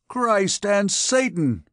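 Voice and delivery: high, slow cry of outrage; outraged exclamation. A narrator's reading. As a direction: exclaim it as a drawn-out oath. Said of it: "exclaimed the captain".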